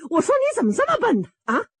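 high, quick scolding; exasperated. A narrator's reading. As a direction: scold him in a high, impatient burst. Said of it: "这盖子奶奶可急了".

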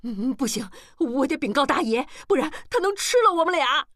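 quavering start rising high and fast; trembling. A narrator's reading. Maid Li Ma dreads the master's anger, trembling. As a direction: stammer it out in a growing panic. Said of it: "李妈哆嗦道".

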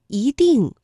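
"一" rising as yí before 定; 一 in second tone. Shown as a demonstration. Teacher gives learners an example, "一" rising yí. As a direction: lift "一" into a second tone. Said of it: "这个一在这里读一".

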